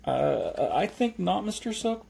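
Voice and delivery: high-pitched, halting polite no; stammer, then decline in a high voice; hesitant refusal. Acted. Jeremy turning down the milkman, hesitant.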